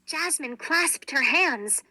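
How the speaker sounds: ordinary delivery, nothing marked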